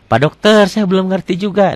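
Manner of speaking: a hint of a confused patient appealing to the doctor; puzzled patient. Voices learner complaining to tajwid doctor. (pasien bingung, sedikit diperankan dengan nada tinggi)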